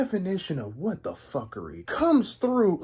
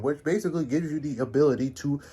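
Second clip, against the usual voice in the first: ordinary talk, nothing marked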